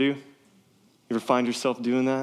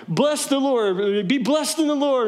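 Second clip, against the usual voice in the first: high, slow, sing-song self-praise; mock pious Saul; 11 semitones higher, slower than usual. Saul gloating to Samuel, mock pious and high. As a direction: ham up a smug king blessing himself. Saul voiced in retold scene.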